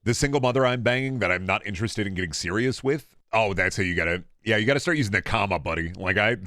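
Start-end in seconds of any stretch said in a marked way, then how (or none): none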